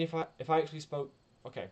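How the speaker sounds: normal talking voice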